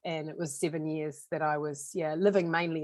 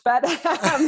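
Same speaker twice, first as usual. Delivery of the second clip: real laugh breaking through the words; 10 semitones higher, 10 dB louder than usual. Real, not acted. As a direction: laugh it off, high and loud. Laughs at own drinking story.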